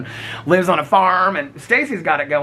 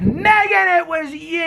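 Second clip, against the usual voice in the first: playful call-out; 10 semitones higher, slower than usual. Real, not acted. Calling out a commenter.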